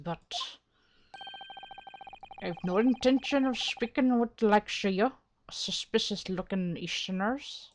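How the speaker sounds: gruffly dismissive Irish governor; attempted Irish accent. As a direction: send them packing in a brogue. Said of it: "doing this man's voice is gonna be a struggle".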